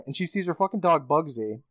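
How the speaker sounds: ordinary talk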